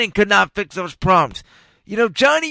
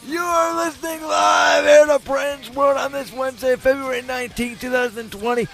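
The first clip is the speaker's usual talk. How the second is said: ringmaster; 7 semitones higher than usual. Acted. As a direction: announce it like a circus ringmaster. Radio host opening hour two.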